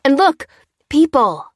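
high, loud exclamation; excited discovery. Acted. Dan and Lucy spotting people at the window, excited. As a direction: call out in excited surprise.

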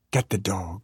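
subdued opening call; held-back yell. A narrator's reading. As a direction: start the yell small. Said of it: "my mother yelled".

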